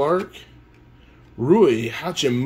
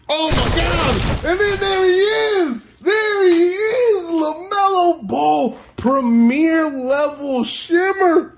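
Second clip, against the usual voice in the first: very high, loud, slow ecstatic yell; ecstatic screaming; 4 dB louder, slower than usual. Real, not acted. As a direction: scream with disbelieving joy. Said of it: "I'ma cry".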